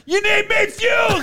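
very high imitated fan scream; mock top-of-lungs yell. Superfan offering wrestler help, screaming. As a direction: call out eagerly to the ring. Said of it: "At the top of his lungs".

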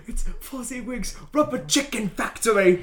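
high, hammed-up title read; mock announcer. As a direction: announce it like a silly movie title. Mocks the silly title line.

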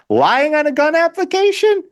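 high, squealed mock incredulity; mock disbelief. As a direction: shoot up high as if absurdly scandalized. Mocking excuses for Hunter's charges.

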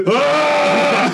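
loud mock yell, high and muffled; pillow yell. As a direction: let out a playful scream into a pillow. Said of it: "Yelling into a pillow".

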